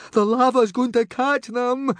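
high, loud anguished wail; loud, high-pitched dread; groaning alarm. Acted. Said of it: "groaned Fishlegs".

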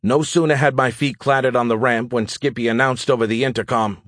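ordinary delivery, nothing marked

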